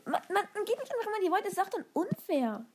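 high, fast outraged whine; indignant complaint. Real, not acted. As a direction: protest the unfairness. Indignant complaint after loss.

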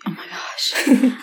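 breathless whispered gasp of disbelief. Real, not acted. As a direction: whisper it, stunned. Shocked reaction to hockey-stick story.

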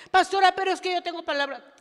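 begging to be heard, rushing the words; whiny protest. Acted. Believer pleading with the pastor, fast whiny protest. Acted back-and-forth with pastor.

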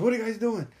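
boss calling out. Retold or imitated. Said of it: "She goes".

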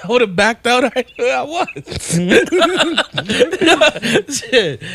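high-pitched laughing through the words; words breaking into big high laughter. Real, not acted. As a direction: crack up mid-sentence and keep talking. Cracking up at the revelation.

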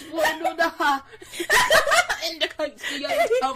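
put-on laughing through a Scottish accent; struggling mock Scottish reading. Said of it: "read it in a Scottish accent".

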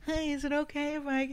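high, small pleading voice; mock-humble supplicant asking a favor; meek begging. Mimed begging younger podcasters for a spot.

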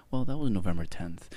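normal talking voice

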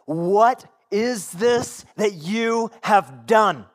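"What is this that you have done" drawn out loud and slow, each word hit; exclaimed accusation. Acted. Sailors to Jonah, "What is this that you have done" exclaimed slow and loud. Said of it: "They use an exclamation mark".

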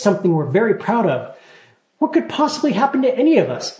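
high, rushed, overly cheerful; hasty reassurance. A narrator's reading. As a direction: gabble to cover it up. Talia hastily reassures Linus.

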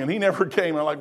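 usual speaking voice

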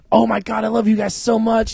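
high, hammed-up gushing; gushing fan. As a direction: fawn like a starstruck fan. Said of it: "I was like".

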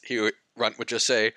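ordinary talk

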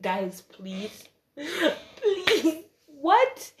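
high, disbelieving please; squeaky incredulous what; exasperated plea. Retold or imitated. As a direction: beg them to make it make sense.

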